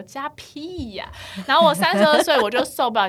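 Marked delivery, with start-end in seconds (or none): 0.0-1.1 a very slow, soft grumble under the breath; muttered retort. Retold or imitated. Woman silently cursing a relative's nagging, slow and under her breath. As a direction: mutter the real thought to yourself.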